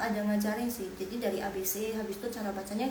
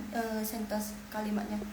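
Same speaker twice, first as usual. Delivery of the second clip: ordinary delivery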